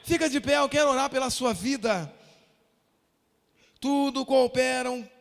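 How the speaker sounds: loud, punched pulpit declarations; emphatic preaching. Real, not acted. Pastor calling everyone to stand for prayer, emphatic preaching. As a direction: punch the key words to the congregation.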